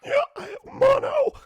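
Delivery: high, loud, strained wail; inhaled creature voice. Acted. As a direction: squeeze it out breathing in.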